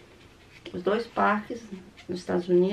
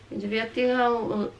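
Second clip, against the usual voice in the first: ordinary talk, nothing marked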